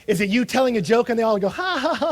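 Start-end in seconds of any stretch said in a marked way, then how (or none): 1.5-2.1 mock crowd laughter. Mimics crowd laughing at his joke.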